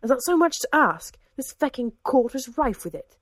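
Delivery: angry outburst. A narrator's reading. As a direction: rail against the court. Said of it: "hissed Richard".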